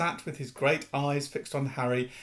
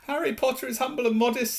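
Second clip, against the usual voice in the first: high, adoring elf voice; reverent; 11 semitones higher, faster than usual. Acted. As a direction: praise your hero reverently. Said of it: "said Dobby reverently".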